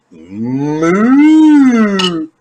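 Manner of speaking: loud, high mooing cow noise; a silly drawn-out cow moo; mock cow moo. Chasing the hormones out of the chicken, a loud mock moo.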